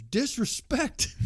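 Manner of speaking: slow, high, stretched word; drawn-out disrespect. Retold or imitated. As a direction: draw it out mockingly. Quoting a wrestler's one word.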